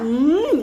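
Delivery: long savouring "mmm"; demonstrated yummy hum. Shown as a demonstration. Coach checks followers understand the sound, relishing hum. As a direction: relish the bite in one hum. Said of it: "ah, macam tu".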